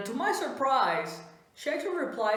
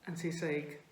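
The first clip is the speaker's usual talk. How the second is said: ordinary talk, nothing marked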